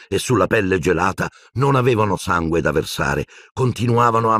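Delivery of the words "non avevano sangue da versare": ordinary delivery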